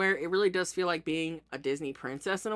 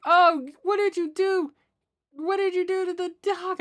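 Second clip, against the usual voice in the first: voicing a pet's complaint; 10 semitones higher, 4 dB louder than usual. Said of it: "So it's like".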